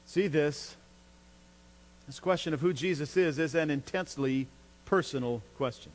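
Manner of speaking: ordinary delivery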